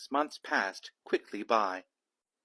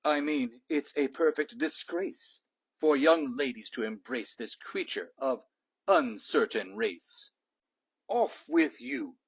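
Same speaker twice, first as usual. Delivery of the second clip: louder, haughty aristocrat voice; pompous Lord Cuckoo-Face; 3 dB louder than usual. Acted. Lord Cuckoo-Face scolding the girls about the dog, haughty and disgusted. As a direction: sneer it like a disgusted old lord. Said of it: "said Lord Cuckoo-Face".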